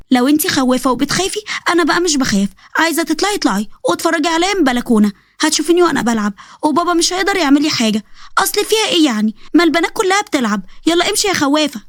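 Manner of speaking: a bold kid mocking her scared twin; defiant little girl. Twin sister mocking the scared one, high defiant child voice.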